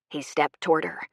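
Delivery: ordinary talk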